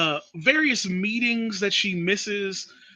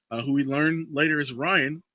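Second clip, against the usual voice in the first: ordinary delivery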